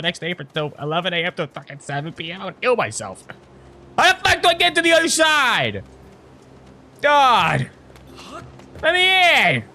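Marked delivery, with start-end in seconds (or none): 4.0-5.8 real outburst at being stuck; frustrated yell. Real, not acted. Stuck in game, yelling out. 7.0-9.6 high, frustrated gamer yelp; real outburst at being blocked; exasperated cry. Real, not acted. Stuck in game, exasperated outbursts.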